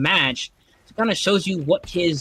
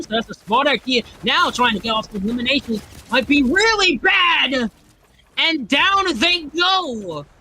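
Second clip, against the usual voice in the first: excited play-by-play; 5 semitones higher than usual. Real, not acted.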